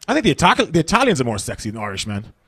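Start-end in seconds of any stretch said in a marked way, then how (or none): none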